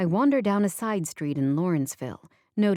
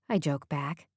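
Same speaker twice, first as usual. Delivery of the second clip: plain reading voice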